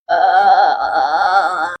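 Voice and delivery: low moaning groan; zombie groan.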